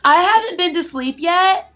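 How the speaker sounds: mock-entitled whine to the seatmate; mock whiny complainer. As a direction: parody her complaint. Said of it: "being like".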